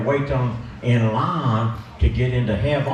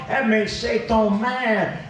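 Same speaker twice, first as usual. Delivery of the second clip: ordinary talk, nothing marked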